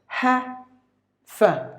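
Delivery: "heifer" slowly split, HEH... fuh, no R; British "heifer" sounded out syllable by syllable; two syllables. Shown as a demonstration. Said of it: "two syllables".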